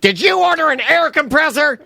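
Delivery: high, shouted accusing question; mock outraged wife. Wife confronting him over an online order, imitated indignant shout. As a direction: imitate an angry wife demanding an answer. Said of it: "Andrea's not talking to me right now".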